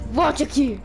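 chamando alto para voltar; shouting. Acted. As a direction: grite volte aqui. Vilão persegue o herói.

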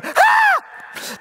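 sharp, very high shocked gasp; a crowd's high startled "Ah!". Acted. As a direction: gasp as if the whole court is scandalised. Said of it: "everyone in the courtroom would have gone".

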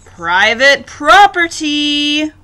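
loud, drawn-out storybook yell. A narrator's reading. Hank warning off the tortoise, yelling. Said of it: "yelled Hank".

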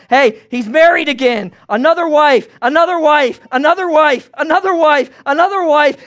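loud, high mocking chant; shouting. Crowd shouts about the king's weddings, loud. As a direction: call it out over the crowd. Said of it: "there's shouts of".

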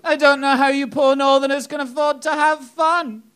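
high-pitched mock pity; patronising London woman. Woman pitying poor northerners, high patronising imitation. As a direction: put on a high, condescending voice of sympathy. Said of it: "when she went".

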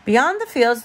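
ordinary delivery

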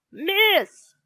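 high, loud pupil calling the teacher; shouting. A narrator's reading. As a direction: shout out to tell on someone. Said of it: "shouted Inky Ian".

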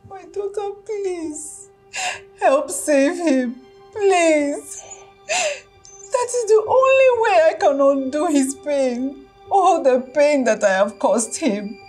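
tearful begging. Acted. Mother begging in tears.

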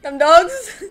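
loud high laughing taunt; gloating laugh-shout. Real, not acted. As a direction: cackle and yell at the beaten enemy. Gloating after a kill.

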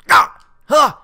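two short, loud startled yelps; freaked-out yelps. Real, not acted. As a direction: yelp as if you scared yourself. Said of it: "I freaked out".

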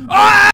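sudden high, loud yelp; short burst of a scream; startled yell. Real, not acted. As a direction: cry out mid-sentence as if attacked. Attacked while talking.

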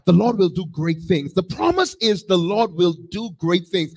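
ordinary talk, nothing marked

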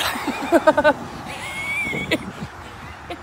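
long breathy laughing fit climbing high. Real, not acted. Drunk friend cracking up at his buddy, long high laugh.

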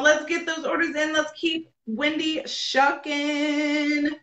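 slow announcer-like drawn-out cheer; rallying call. Real, not acted. Host urging viewers to get orders in, rallying. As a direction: hold the last word long.

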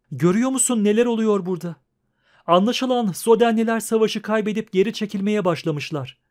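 high-pitched, agitated urging; panicked. A narrator's reading. Dandelion warning Geralt about the rout, panicked. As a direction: press a friend to flee now.

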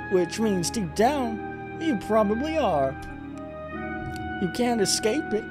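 high, theatrical falsetto woman; seductive villainess. Acted. Succubus telling Jack they are both monsters, high villainess voice. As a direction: lecture him in a high, hammed-up female voice.

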